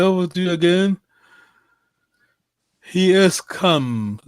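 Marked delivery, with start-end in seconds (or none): 2.9-4.2 He has come said very slowly with weight on has; slow model sentence. Shown as a demonstration. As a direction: stretch out He has come for the learner. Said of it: "third singular".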